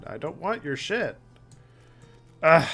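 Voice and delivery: normal talking voice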